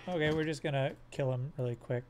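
ordinary talk

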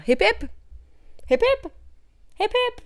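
high, rising cheer call. Real, not acted. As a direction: lead the crowd in a cheer. Leading three cheers for Hannah.

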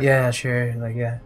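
low, quiet demo of a meek character; imitating a soft-spoken anime type. Shown as a demonstration. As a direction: say it small and soft. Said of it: "She's like the soft-spoken".

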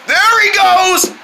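slow, high, stretched out; drawn-out high announcement. Acted.

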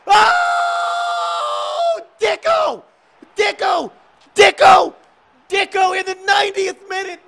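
extremely high, loud repeated screams of the name; goal celebration shouting. Real, not acted. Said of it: "I said I wasn't gonna be loud".

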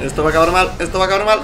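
high loud worried cry; alarm shout. Real, not acted. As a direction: shout it as things fall apart. Fight going badly.

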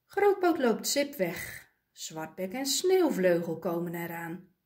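ordinary delivery, nothing marked

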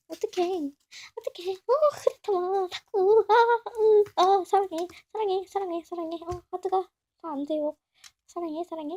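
high, gushing I love you. Real, not acted. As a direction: coo over the gift, voice up high. Gushing over the gift.

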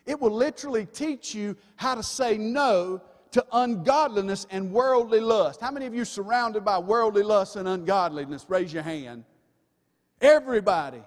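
loud, high fervent preaching; full-force preaching. Acted. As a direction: preach it out with rising fervor.

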